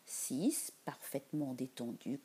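plain reading voice

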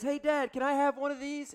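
put-on kid voice. Son begging for candy.

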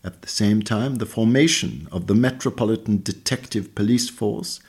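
ordinary talk

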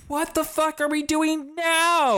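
hammed-up fed-up Wanda; exasperated Wanda. Wanda exasperated while fleeing.

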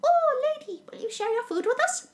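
very high, small chirpy bird voice; birds begging. Retold or imitated. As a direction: squeak it up like a little bird asking for crumbs. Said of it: "and the birds go".

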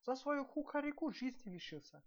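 high plaintive servant voice; whispered lament. A narrator's reading. Servant mourning the executed rooster, high plaintive lament. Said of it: "шепнул другой".

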